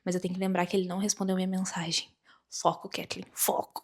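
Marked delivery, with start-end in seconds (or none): 2.5-3.8 hushed note to self; self-admonishing. Real, not acted. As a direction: quietly pull yourself back on topic. Scolding herself back on topic.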